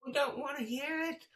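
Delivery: exasperated, high-pitched heckling; fed-up heckler yell. Retold or imitated. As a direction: shout the preacher down in imitation.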